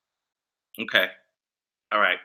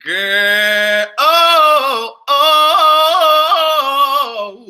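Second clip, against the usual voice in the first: loud drawn-out wail of disbelief; loud disbelieving oh; 4 semitones higher, 7 dB louder, much slower than usual. Real, not acted. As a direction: groan it out loudly. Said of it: "reacting in disbelief".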